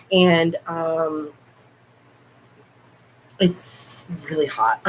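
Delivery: ordinary delivery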